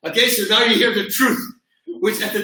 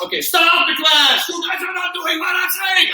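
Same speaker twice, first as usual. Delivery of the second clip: angry instructor shout; 10 semitones higher than usual. Retold or imitated. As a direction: bark the class to a halt, fed up. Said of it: "I'm getting angry".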